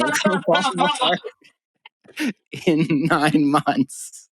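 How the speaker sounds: words dissolving into laughter, slowed way down; can't get through the letter for laughing. Real, not acted. Telling friends about the fake manager, laughing through it.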